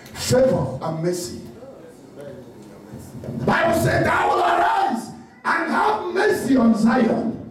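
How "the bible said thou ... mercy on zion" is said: shouted preaching over music; fiery preacher sermon. Acted. Preaching at full force.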